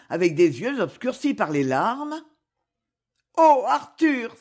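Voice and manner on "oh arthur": high, loud cry of distress; anguished cry. A narrator's reading. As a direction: call out to him in anguish. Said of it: "s'écria-t-elle". (cri de détresse)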